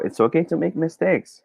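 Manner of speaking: ordinary talk, nothing marked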